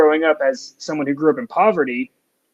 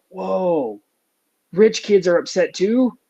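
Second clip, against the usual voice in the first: mock surprise; 5 semitones higher, slower than usual. Mock disbelief at rich kids.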